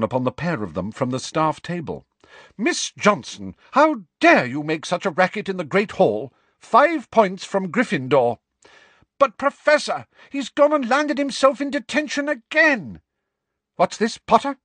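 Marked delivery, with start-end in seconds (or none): 2.6-8.4 higher, clipped rebuke rising in volume; strict professor scolding a pupil; stern scolding. A narrator's reading. Teacher scolds shouting pupil. 9.2-13.0 put-on much higher, indignant young voice; angry student protesting to her teacher; protesting girl. A narrator's reading. 13.8-14.6 higher, louder, curt question; stern teacher demanding answers; sharp demand. A narrator's reading. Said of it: "said Professor McGonagall sharply".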